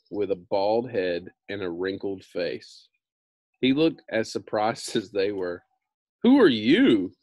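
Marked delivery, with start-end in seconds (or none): 6.2-7.1 sharp, loud startled question; cried out. A narrator's reading. Tin Woodman crying out at the stranger, loud. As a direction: call it out in shock. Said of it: "the Tin Woodman cried".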